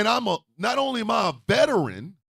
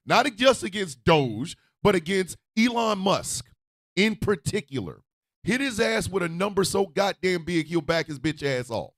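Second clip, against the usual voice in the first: ordinary talk, nothing marked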